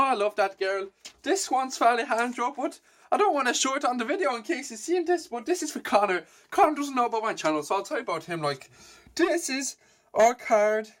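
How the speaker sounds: put-on high, cheery Scottish accent. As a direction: keep up the playful Scottish voice. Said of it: "when I talk Scottish".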